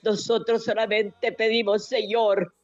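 rising, pleading prayer with tearful catches; fervent prayer. Acted. Woman praying for the suffering and the jailed, tearful and pleading. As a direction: pour it out to God, voice near breaking. Leads a long intercessory prayer.

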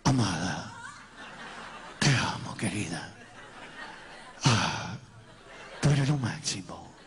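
very low, slow, hammed-up announcer croon; radio announcer voice. Suitor wooing his sweetheart from the throat, radio announcer voice. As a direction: put on a deep locutor voice for sweet talk. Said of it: "así como en voz de locutor".

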